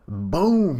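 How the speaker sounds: loud, high burst of a sound effect; excited boom. Real, not acted. Reader hyped at the slam, loud high boom. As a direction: shout the impact like a hype fan. Narrating big hit excitedly.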